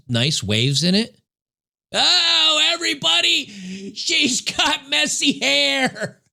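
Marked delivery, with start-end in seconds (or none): 1.9-6.2 much higher, slow, whiny mock of her; mocking girly voice. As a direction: parody her in a high sneering voice. Mocking the woman's claim.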